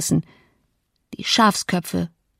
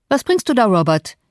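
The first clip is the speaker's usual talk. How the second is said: mildly raised call from the door; 3 dB louder than usual. A narrator's reading. Master at the door questioning his servant, calling out. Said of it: "rief Mister Linton an der Tür".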